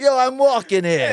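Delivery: loud, high New York shout; New York accent. Retold or imitated. Over-the-top New York accent, joking with the interviewer. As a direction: bellow it like a cartoon New York cabbie. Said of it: "moved here from New York City".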